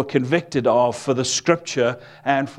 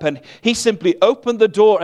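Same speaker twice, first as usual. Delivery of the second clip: ordinary delivery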